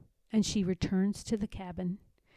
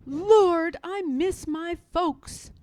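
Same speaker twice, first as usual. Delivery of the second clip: higher, louder, slow cry out to God; anguished cry; 8 semitones higher, 8 dB louder, slower than usual. A narrator's reading. Worn-out Harriet cries to God for her family, anguished. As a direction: cry out the longing for home.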